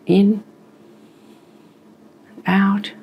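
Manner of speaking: high, drawn-out in and out; coaching a breath in and out; guided breathing cue. Shown as a demonstration. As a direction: pace the patient's breath with each word. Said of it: "So let's try that".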